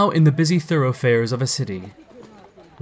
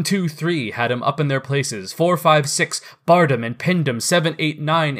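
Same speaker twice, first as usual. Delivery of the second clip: usual speaking voice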